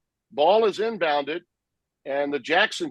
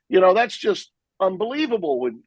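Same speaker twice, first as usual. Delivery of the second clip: ordinary talk, nothing marked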